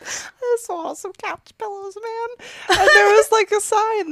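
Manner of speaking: high, giggly and struggling to talk; laughing through words. Real, not acted. Friend giggling as she starts her pillow meltdown story, laughing through words.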